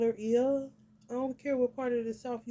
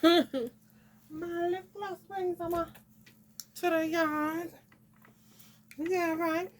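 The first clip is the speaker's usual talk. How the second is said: higher, slow, laughing diva voice; high sassy voice, drawn out; sassy put-on voice; 8 semitones higher, 4 dB louder, slower than usual. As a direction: ham up a sassy diva bragging.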